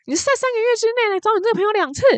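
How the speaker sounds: hammed-up officer barking the question; mock roar. Customs officer roaring at the traveler, hammed-up high mock yell. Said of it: "当他嘶吼说".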